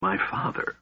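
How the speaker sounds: soft, breath-only whisper of dread; fearful whisper. A narrator's reading. Alhana fearing for her father, fearful whisper. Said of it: "whispered in a voice filled with fear".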